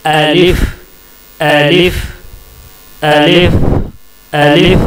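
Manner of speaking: अलिफ repeated with a light rising tone; Arabic letter alif. Shown as a demonstration. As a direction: drill अलिफ with a slight lift each time. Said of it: "आप खुद कोशिश करके देखिए". (अलिफ दोहराते हुए हल्का उठता सुर)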